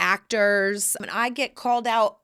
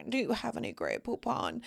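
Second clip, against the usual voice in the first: mock posh snob; 3 semitones higher, 9 dB quieter than usual. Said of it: "They were in their car like".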